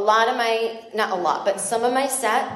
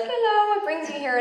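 cheery border guard; 7 semitones higher than usual. Retold or imitated. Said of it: "the guy was like".

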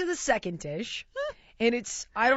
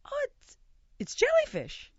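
much higher, startled squeak; mock alarmed. Teller shocked at the jellyfish dish, higher squeaky alarm.

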